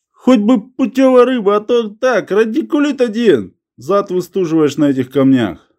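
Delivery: high-pitched, exasperated complaint; old man griping. A narrator's reading. Grandad scolding his companion about pointless fishing, exasperated.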